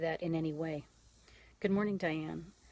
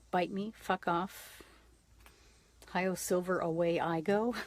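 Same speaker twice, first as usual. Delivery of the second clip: loving brush-off; 3 dB louder, slower than usual. Pretend. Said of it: "just very lovingly say".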